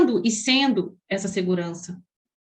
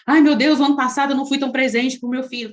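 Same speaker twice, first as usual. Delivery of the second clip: louder, fast anxious lament; guilty parent lament; 6 dB louder than usual. Retold or imitated. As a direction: rush it like a guilt-ridden mother. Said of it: "Ai, o vazio". (lamento culpado, mais alto e rápido)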